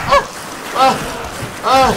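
short sharp cries of frustration; frustrated yells. Real, not acted. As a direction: yell out in annoyance. Frustrated yells at bugged game.

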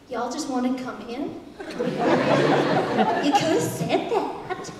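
low, soft deadpan reply; laid-back American pig. Acted. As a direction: say it slow and unimpressed after their pleading. Third pig, plain American, answers.

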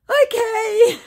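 very high, loud put-on okay; mock scammer okay. Scammer who didn't read her message, mock vacant.

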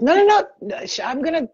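put-on high-pitched Indian accent; lilting mock Indian accent, protesting. Psychic balks at doing a spirit's voice, Indian accent. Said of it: "don't make me do the accent".

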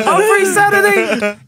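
high, loud laughing eagerness; eager laughing yes. Retold or imitated. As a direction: jump at the gig offer, laughing. Eager reply after hearing pay.

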